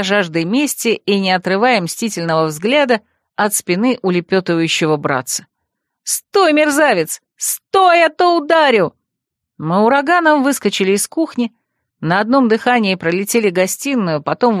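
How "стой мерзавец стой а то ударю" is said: high furious yell; shrill-pitched threat on the chase; angry shout. A narrator's reading. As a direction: shout after a fleeing thief. Furious chase after fleeing brother.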